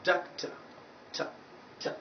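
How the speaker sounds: "Doctor" with weak "tuh", then "ta" alone; isolated schwa. Shown as a demonstration. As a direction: break off the soft "ta" ending of "Doctor". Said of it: "it is taking this vowel sound".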